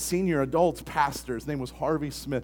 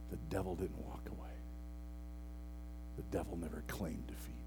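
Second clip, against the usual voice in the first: whispered sermon; 7 semitones lower, 15 dB quieter than usual. Acted. Pastor quietly warns the congregation, hushed whisper. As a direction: lean in and whisper it.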